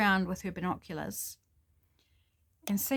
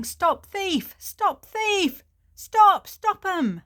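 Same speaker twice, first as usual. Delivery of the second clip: much higher and louder, full-throat shouting; yelling; 12 semitones higher, 9 dB louder, much slower than usual. Acted. Axe-wielding man chasing a thief, high loud shouting. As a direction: bellow it down the street after the thief. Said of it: "somebody yelling".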